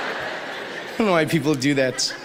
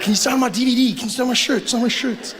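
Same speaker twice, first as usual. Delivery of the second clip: gushing fan; 8 semitones higher than usual. Retold or imitated. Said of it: "You'll be like".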